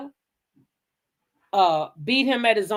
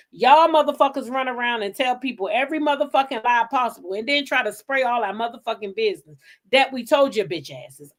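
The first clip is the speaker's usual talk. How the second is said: ordinary talk, nothing marked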